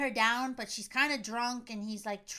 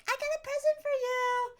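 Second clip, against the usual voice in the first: high sing-out mock excitement; put-on gleeful squeal; excited gift-giver; 14 semitones higher than usual. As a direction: announce a surprise gift in a high girly imitation. Acting out Jamie giving a gift.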